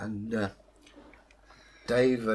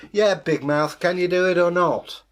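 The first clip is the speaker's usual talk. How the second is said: loud, higher taunting dare; goading challenge; 6 semitones higher, 8 dB louder than usual. Acted. Dave dares the devil into the pot, goading. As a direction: goad the boaster loudly.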